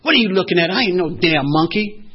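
loud, indignant snapback at the cop; retold angry retort. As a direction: fire it back at him, insulted and loud. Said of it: "It was making me angry".

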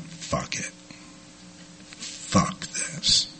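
barely voiced whisper, almost no sound; whispered inner voice. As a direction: let it slip out as a resigned whisper. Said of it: "something inside me went".

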